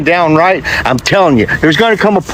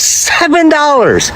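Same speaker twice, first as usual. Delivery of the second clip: incredulous; 9 semitones higher, slower than usual. Real, not acted. Outraged at grocery prices.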